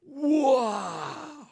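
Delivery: high, breathy little gasp of wonder; a kid's awed wow; imitating a child's amazement; amazed wow. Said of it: "Sam looking at me going".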